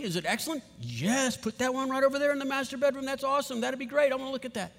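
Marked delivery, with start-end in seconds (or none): 1.4-4.7 excited homeowner. Pretend. Homeowner placing welcome boxes.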